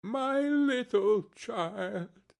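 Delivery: very high, drawn-out cry of grief; broken-down wail. A narrator's reading. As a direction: stretch it slowly into a grieving wail. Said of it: "He broke down all at once".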